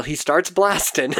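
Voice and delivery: normal talking voice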